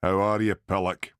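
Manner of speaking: put-on heavy Glasgow accent greeting. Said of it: "in his heavy Glasgow accent".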